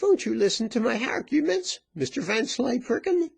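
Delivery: high-pitched coaxing threat; high, domineering question; insistent virago. A narrator's reading. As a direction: press him in a high woman's voice. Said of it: "continued the woman".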